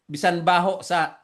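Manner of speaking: ordinary talk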